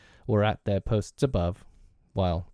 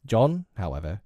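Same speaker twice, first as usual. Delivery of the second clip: plain reading voice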